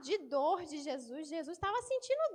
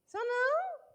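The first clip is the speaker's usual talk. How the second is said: denial; 8 semitones higher, 4 dB louder, slower than usual. Acted. Peter denying.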